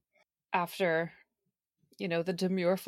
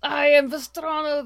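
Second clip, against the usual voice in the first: put-on Russian accent; 6 semitones higher, 9 dB louder than usual. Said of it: "thick Russian accent".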